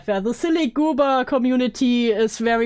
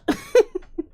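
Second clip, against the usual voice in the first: bright high giggle; high laugh; 9 semitones higher than usual. Real, not acted. Laughs at being called out.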